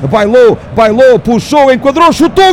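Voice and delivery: building to a shout; rising goal call. Real, not acted.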